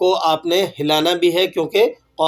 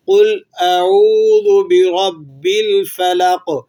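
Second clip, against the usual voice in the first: slow, louder chanted tilawat; Quran recitation; 4 dB louder, slower than usual. Shown as a demonstration. Teacher recites the whole verse for students. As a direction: recite the verse in full tajweed. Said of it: "پوری آیت مبارکہ کو دوبارہ سے سن لیجئے".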